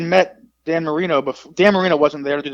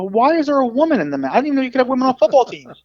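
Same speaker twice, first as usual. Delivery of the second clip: high, put-on clueless protest; mock bafflement; 11 semitones higher than usual. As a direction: play dumb, pitched way up, to needle the opponents. Feigned confusion to needle football players.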